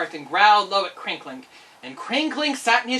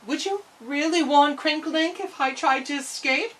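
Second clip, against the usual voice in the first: ordinary delivery, nothing marked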